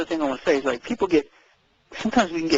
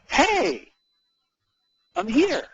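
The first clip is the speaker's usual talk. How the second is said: bright, pitched-up hello to the room; upbeat greeting; 7 semitones higher than usual. Shown as a demonstration. Coach showing Linda how to greet a room, upbeat. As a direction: arrive and announce yourself cheerfully. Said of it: "choose to be in that context of".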